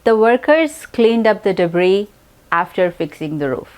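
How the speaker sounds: slow, clear dictation pace; slow example sentence. Shown as a demonstration. Teacher reading an example sentence slowly. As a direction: read the sentence slowly for learners. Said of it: "तो एक example देखते हैं".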